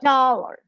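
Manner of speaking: "dollar" with a stressed D and schwa ending; English "dollar", open first vowel, reduced second; English pronunciation. Shown as a demonstration. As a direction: lean on the D of "dollar". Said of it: "Si lo digo en inglés diría".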